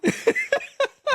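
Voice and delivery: real burst of high laughter; laughing at her own story. Real, not acted. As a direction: break into giggles. Laughs while retelling.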